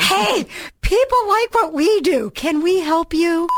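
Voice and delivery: high, slow, chipper voice; sing-song helpful exec; cheery movie exec. Retold or imitated. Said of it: "walk down the hall to the movie people and be like".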